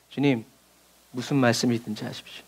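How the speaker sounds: a gentle surrendered plea; reverent quiet prayer. Acted. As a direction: hush it like a humble prayer.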